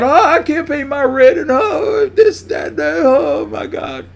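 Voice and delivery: high, whining voice of people on the news; mock the complainers with a high whine; mock whiny complainer. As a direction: parody of tenants crying to the local news. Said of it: "talking about".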